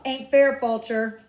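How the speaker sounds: put-on loud, high angry kid; angry protest. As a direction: shout the injustice.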